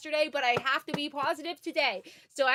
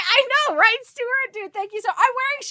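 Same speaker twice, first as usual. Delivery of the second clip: excited; 7 semitones higher, 6 dB louder than usual. Real, not acted. Excited chat reaction.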